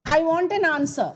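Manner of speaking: insistent command, give me an answer; commanding demand. Shown as a demonstration. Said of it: "example for imperative sentence".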